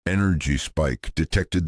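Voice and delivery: ordinary delivery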